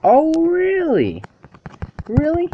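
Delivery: very high, slow "Oh, really?"; squeaky mock disbelief. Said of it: "repeated with mock shock".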